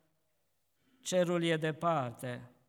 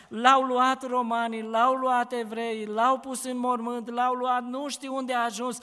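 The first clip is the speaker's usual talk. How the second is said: Mary's lament, raised and louder, pleading; weeping Mary; 4 semitones higher, 3 dB louder than usual. Acted. Mary to the gardener, lamenting her lost Lord. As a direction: cry out the loss of the Lord, voice lifted.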